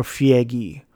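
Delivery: Feige said as fee-EH-ghee; alternate pronunciation. Shown as a demonstration. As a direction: split Feige into three syllables, "fee-EH-ghee". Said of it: "or Feige".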